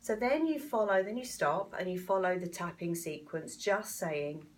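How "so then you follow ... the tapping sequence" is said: ordinary delivery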